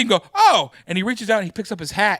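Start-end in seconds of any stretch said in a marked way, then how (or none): 0.4-0.7 high, loud surprised oh. Retold or imitated. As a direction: blurt it out on noticing. Concert-goer finds his hat.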